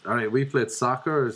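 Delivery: ordinary talk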